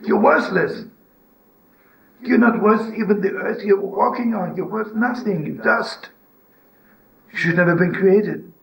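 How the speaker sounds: high, scornful condemnation; the inner judge condemning a man, high and scornful. Retold or imitated. Voice condemning another.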